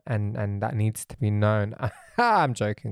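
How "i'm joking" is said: usual speaking voice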